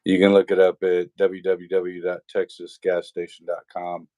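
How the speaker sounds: ordinary delivery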